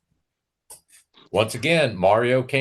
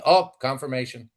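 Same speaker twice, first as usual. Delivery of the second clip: usual speaking voice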